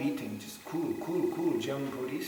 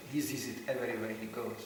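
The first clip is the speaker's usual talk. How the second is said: normal talking voice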